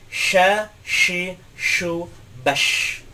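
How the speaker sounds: "Sha, shi, shu, bash" with a clear sh hiss; teacher drills the shin letter; Arabic shin. Shown as a demonstration. Teacher drilling shin for students, clear sh.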